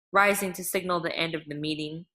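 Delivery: plain reading voice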